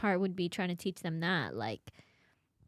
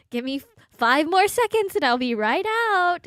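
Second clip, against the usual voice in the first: higher and louder, hurried promise through a door; mock-patient parent stalling a crying child; pleading stall; 8 semitones higher, 8 dB louder than usual. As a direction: call out to buy a few more seconds.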